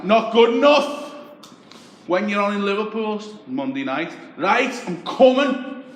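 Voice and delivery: high and loud Scouse yell out the window; shouting taxi driver. Retold or imitated. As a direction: call out from the cab across the street.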